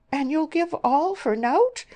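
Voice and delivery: loud, very high, incredulous cry. A narrator's reading. Mother appalled at her daughter's choice, crying out. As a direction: cry out in protest. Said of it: "cried Missus Arden".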